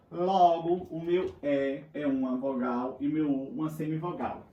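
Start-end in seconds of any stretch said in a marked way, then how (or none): none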